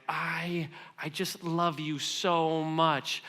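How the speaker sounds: slow, tender, almost breathless; tender confession. Retold or imitated. Uncle telling his little nephews he loves them, slow and tender. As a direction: let the love spill out slowly, stunned by it.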